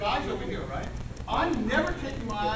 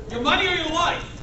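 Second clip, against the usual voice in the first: higher, louder mock hold-up; mugger's demand; 7 semitones higher, 8 dB louder than usual. Robber to Jack Benny in a retold routine, loud demand. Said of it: "quintessential Jack Benny moment".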